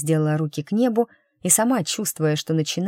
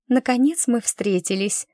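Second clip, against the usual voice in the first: put-on lilting higher girl voice; sweet girl voice; 7 semitones higher than usual. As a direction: play the sweet, eager girl, pitched up. (милая девушка, повыше голос)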